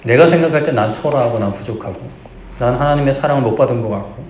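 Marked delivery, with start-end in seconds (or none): none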